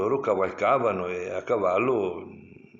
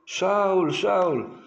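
shouted high and loud across the desert; calling out; 11 semitones higher, 5 dB louder than usual. Acted. David calling out to Saul from the cave, loud shout. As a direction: call his name out loud to a distant king. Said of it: "uscì dalla grotta e gridò".

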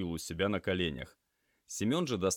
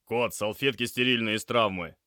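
loud, higher-pitched command; urgent order; 5 semitones higher, 7 dB louder than usual. Acted. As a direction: call for supplies in an emergency.

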